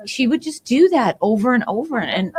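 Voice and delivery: ordinary talk, nothing marked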